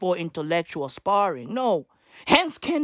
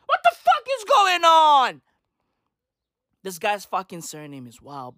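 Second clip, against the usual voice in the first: usual speaking voice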